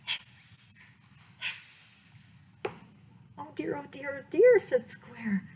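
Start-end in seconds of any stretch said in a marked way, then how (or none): 0.1-2.1 repeated breathy hissing; snake hiss. Acted. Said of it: "said Triangle". 3.4-4.6 fretful worry. Acted. Frightened Square fretting.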